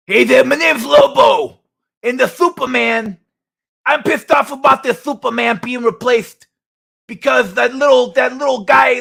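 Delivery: high, loud, pissed-off wrestler-style champion; Lobo. Acted. Streamer plays a champion griping about Superman, loud impression. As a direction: belt it out high, indignant and pushed. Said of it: "I'm gonna try to do World Champion Lobo".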